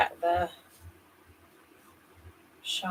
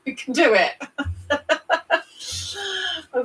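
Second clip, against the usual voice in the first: laughing; 8 semitones higher, faster than usual. Real, not acted. Laughing encouragement at cutting paper.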